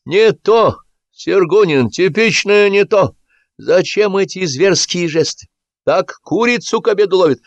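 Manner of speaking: high, drawn-out director's shouting; exasperated director yelling at an actor; shouted directions. A narrator's reading. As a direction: call out corrections theatrically across the beach. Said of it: "кричал с раскладного стула в сторону моря".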